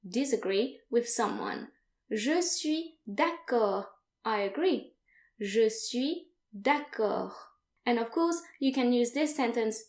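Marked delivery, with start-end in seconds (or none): 2.1-3.9 slow model phrase. Shown as a demonstration. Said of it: "teacher models the phrase". 5.4-7.3 slow model phrase. Shown as a demonstration. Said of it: "teacher repeats the phrase".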